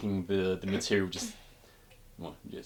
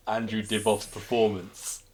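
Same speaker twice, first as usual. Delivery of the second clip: usual speaking voice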